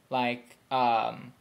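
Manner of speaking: usual speaking voice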